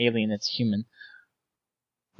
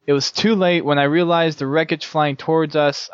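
usual speaking voice

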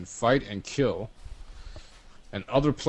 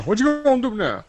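much higher, louder taunt; shrill taunt; 13 semitones higher, 4 dB louder, faster than usual. Pretend.

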